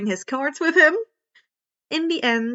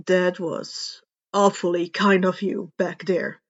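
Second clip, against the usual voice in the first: lightly lowered tired man's voice; a hint of a weary pro hero; gruff hero; 5 semitones lower than usual. A narrator's reading. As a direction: read him a bit lower and dry.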